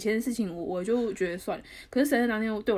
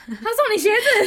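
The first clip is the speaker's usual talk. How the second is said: higher, louder, slow incredulous question; real surprise; 11 semitones higher, 8 dB louder, slower than usual. Real, not acted. Friend hearing the boyfriend gave shoes, higher and louder in disbelief. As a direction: stretch it out in disbelief.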